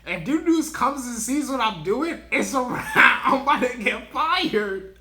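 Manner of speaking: high, loud retelling that breaks into laughter; laughing mock panic. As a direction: crack up while imitating a panicked text. Retold panicky group chat text.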